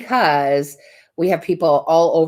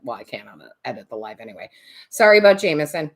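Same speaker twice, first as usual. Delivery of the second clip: ordinary delivery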